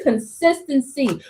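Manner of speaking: ordinary talk, nothing marked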